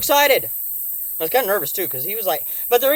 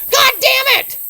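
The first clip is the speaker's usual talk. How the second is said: high loud curse; frustrated shout; 11 dB louder, slower than usual. Real, not acted. Cursing about the grabbers, frustrated high shout. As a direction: blow up at the missed chance.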